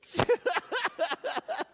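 real burst of high laughter; rising giggle of delight. Real, not acted. Laughs at the boy's answer.

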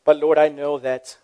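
quiet humble prayer to God. Acted. Leads the closing prayer.

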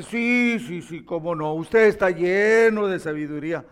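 loud, eager flattery; mock sycophantic praise; fawning yes-men. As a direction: gush like a yes-man agreeing with everything. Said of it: "los otros paleros dicen".

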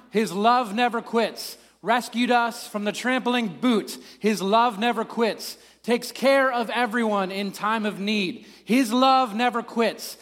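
slow, solemn responsive reading; liturgical reading. Acted. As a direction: lead the psalm with weight and feeling. Leads a responsive psalm reading.

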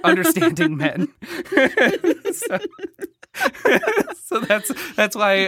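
cracking up with high giggles between words; laughing too hard to finish; real laughter. Real, not acted. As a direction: try to explain through helpless laughter. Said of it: "What I'm laughing at".

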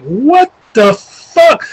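high, very slow disbelief; slow, high-pitched stunned reaction; drawn-out astonishment. Retold or imitated. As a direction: stretch each word out in retold amazement. Retelling shock at chaotic crowd.

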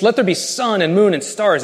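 booming divine decree; God commanding. Acted. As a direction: proclaim it like God speaking creation into being. God's creative command voiced.

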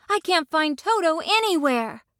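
put-on high, frantic girl's voice; worried cry. A narrator's reading. Dorothy searches the crowd for Toto, frantic.

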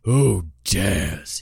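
loud furious demand; roaring. Acted. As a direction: roar the challenge. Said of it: "he roared".

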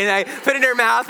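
climbing high and loud with amusement; excited storytelling. Real, not acted. Laughing at own weakness.

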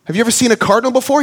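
excited dad. Retold or imitated. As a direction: cheer the kid on. Dad answering his son enthusiastically.

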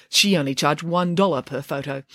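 plain reading voice

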